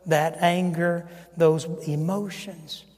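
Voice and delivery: ordinary talk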